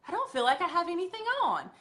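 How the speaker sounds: a hint of a pleasantly surprised client; customer voice. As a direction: play the client amazed how light it feels. Customer's first reaction quoted.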